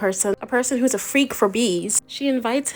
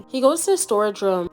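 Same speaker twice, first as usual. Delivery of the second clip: normal talking voice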